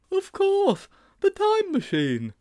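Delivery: put-on high-pitched boyish eureka; excited realization. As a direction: cry it out as the idea strikes. Sponge has sudden idea.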